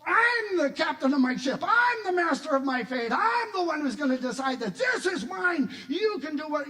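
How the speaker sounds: loud, high, chest-beating proclamation; hard-hearted defiance. Acted. Hard-hearted believer defying God, proud and loud. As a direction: hammer every claim at full volume. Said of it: "if we harden our hearts and say".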